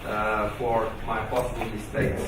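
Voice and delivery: ordinary delivery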